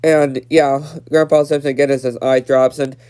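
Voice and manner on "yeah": ordinary talk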